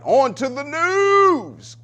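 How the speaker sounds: high, loud, long drawn-out call; rousing announcement. Real, not acted. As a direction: belt out the handover like a showman. Host hypes the news handover.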